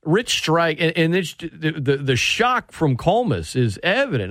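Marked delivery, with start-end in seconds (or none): none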